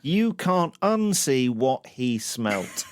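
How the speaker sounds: slowed-down relish of each word; slow savouring quote. Acted. Host relishing his co-host's line, slow savouring delivery. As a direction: drawl it out, savouring the phrase.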